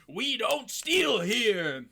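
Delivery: slow, drawn-out mock scolding; stern scolding. Foster mother telling her daughter off for stealing, slow mock-stern scolding. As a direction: raise the pitch and stretch each word in mock sternness.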